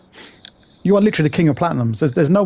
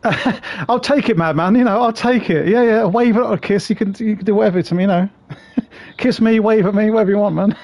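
higher, giggly, teasing banter; laughing mock flirt; 7 semitones higher than usual. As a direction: play up a cheeky come-on through laughter. Joking that Madman can kiss him.

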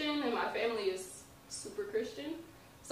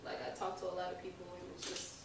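much softer, sinking into a near whisper; fading quietly; 2 semitones lower, 9 dB quieter, faster than usual. Real, not acted. Guest recalling hardship, fading softer. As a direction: let it drain away.

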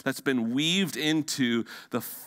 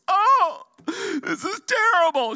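high wailing wife voice, much higher and louder; 1 wife crying; 7 dB louder than usual. Retold or imitated. Pastor telling of a wife crying over a gift, high wail. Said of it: "and she goes".

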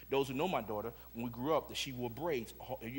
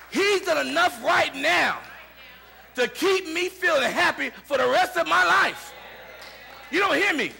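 prédica enérgica; 10 semitones higher, 11 dB louder than usual. Acted. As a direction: drive each phrase home to the congregation at full force. Preaches at full force.

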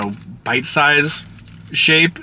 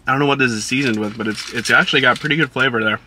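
chewing between words; talking while chewing; faster than usual. Real, not acted. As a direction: mouth partly full of food. Seen 1: Bites burger at -1.0, then talks with slightly full, chewing mouth.

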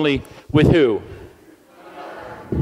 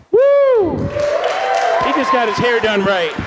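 excited cheer; 8 semitones higher, much slower than usual. Real, not acted. Pastor whooping over a boy's haircut, high and excited.

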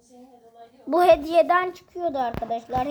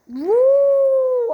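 high, loud amazed vuu; amazed whoop; 11 semitones higher, 6 dB louder than usual. Real, not acted. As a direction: gasp out a loud wow. Amazed at the galaxy effect.

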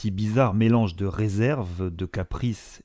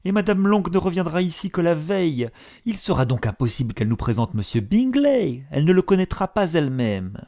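high, louder anxious mother; fretful mother; 9 semitones higher, 4 dB louder than usual. A narrator's reading. The mother frets to her husband, high and fretful. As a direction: fret about the ball, voice raised.

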